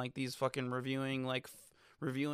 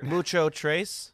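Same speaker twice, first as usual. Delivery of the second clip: slow, louder mock-Spanish flourish; playful Spanish; 5 dB louder, much slower than usual. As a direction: draw out the Spanish theme playfully. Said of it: "I'm giving the Spanish theme".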